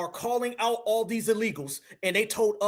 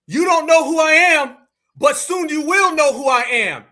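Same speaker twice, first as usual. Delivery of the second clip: shouted, high, louder threat; loud menacing quote; 6 semitones higher, 9 dB louder than usual. Retold or imitated. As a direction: boom it out like a threat. Repeats border guy's threat loudly.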